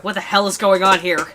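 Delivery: loud, furious demand; angry man. Acted. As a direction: demand answers at the top of your temper. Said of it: "an angry face was staring in".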